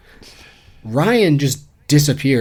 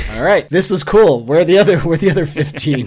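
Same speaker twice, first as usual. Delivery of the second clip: mock tourist; 5 semitones higher, 6 dB louder than usual. Imagined tourist in Italy.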